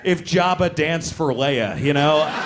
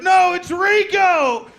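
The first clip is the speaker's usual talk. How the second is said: very high, drawn-out holler; insistent shout; 6 dB louder, slower than usual. Retold or imitated. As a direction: yell back stubbornly, louder and higher. Acted shouting argument, stranger insists.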